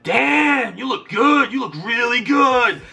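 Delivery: high, slow put-on voice, lingering on good; impressed flirting. Gym guy complimenting Doug, high slow flirty voice. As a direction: pitch up and drawl the compliment. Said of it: "He's like".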